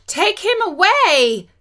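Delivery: high, drawn-out shriek of disgust; narrated scream. A narrator's reading. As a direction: screech it out in horror. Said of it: "she screamed".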